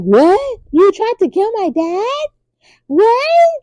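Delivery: high, stunned cry of disbelief; shocked disbelief. Retold or imitated. Prince hearing his father was attacked, high shocked disbelief. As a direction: gasp out the words like the ground just fell away.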